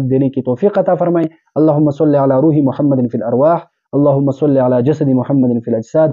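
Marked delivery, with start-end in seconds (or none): 1.5-6.1 measured Arabic durood, chanted cadence; prayer recitation. Acted. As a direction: intone the blessing like closing a sermon. (درود کی تلاوت کے انداز میں)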